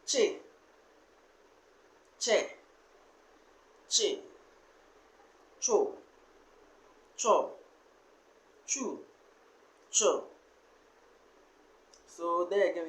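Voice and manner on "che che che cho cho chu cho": each "che" and "cho" said slowly and separately; syllable drill. Shown as a demonstration. Teacher drilling ch syllables for the class, each said separately. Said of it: "So we have cha".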